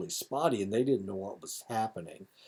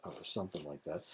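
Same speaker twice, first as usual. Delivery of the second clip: normal talking voice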